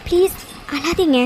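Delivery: slow, gentle plea to stop crying; soothing. Acted. Friend gently comforts sobbing Devaki, slow and soothing.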